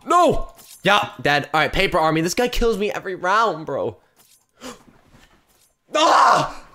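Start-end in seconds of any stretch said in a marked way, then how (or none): 0.0-0.5 shout. Real, not acted. Killed in game. 5.9-6.7 frustrated shout. Real, not acted. Killed again.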